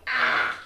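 loud toneless "ah"; mock over-caffeinated outburst; wired yell. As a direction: blurt a jittery coffee-fueled yell. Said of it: "Coffee's got me like".

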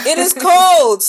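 high and loud, shivering from cold; loud cold complaint. Real, not acted. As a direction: cry out how cold it is. Complaining about cold weather.

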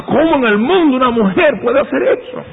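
high shocked disbelief; incredulous. Retold or imitated. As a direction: ask it as if you can't believe it. Incredulous inner thought.

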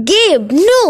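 sharp high cry of protest; alarmed cry. Acted. Mother crying out to stop Gabe, loud and high.

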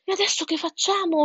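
high, worried cry, not full volume; duck's mild yell. A narrator's reading. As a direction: call out as an alarmed duck. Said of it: "urlò Agata". (papera che esclama con voce acuta)

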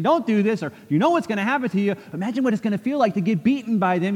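high, quick, fretful warnings; hurried high pleas to stop; anxious warning crowd. Retold or imitated. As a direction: rush the warnings like worried friends. Crowd warning Paul not to go.

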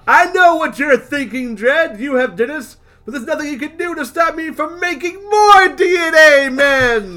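villain taunt. Acted. Villain voiced in comic read.

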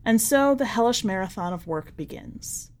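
ordinary delivery

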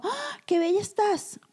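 high, slow gushing praise; mock delighted friend; friend's compliment. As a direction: gush over how she looks.

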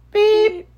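high, loud imitated beep; censor bleep. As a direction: beep out the curse word. Bleeping out a curse word.

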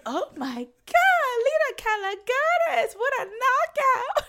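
high, slow swooning gush; mock gushing. Friends swooning over Lena's mini skirt, slow high gush. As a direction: stretch it out like a starstruck fan.

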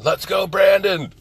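high, loud mock yell, drawn out. Woman dragged off a flight, yelling a defiant slogan. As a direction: belt it like a defiant protester. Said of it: "she's yelling".

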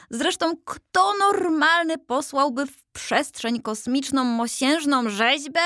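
high, whiny grumbling soldier statue; high-pitched fretful whining; whining complaint. A narrator's reading. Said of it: "Powstaniec biadolił całą drogę".

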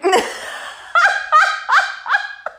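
nervous laugh after an awkward admission; high embarrassed laugh. Real, not acted. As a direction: let out a high, flustered giggle.

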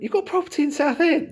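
high-pitched mock disbelief; incredulous question. Host to a man who booed Southend, high incredulous retort. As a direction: query it high, as if caught out by the news.

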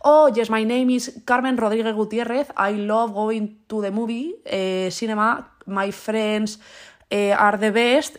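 slow, hammed-up Spanish accent on English; slow mock Spanish accent in English. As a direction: read English like Spanish words, mocking. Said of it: "leo las palabras en inglés como si fuese en español".

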